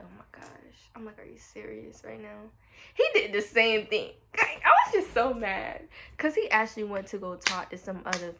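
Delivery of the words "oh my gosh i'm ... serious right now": soft, hushed stunned muttering; hushed disbelief. Retold or imitated. Seeing Jason talk to another girl, hushed disbelief. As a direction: say it under your breath, shocked.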